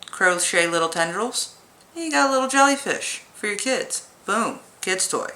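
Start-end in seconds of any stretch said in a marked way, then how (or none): none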